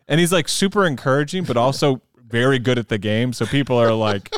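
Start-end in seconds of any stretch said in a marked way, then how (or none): none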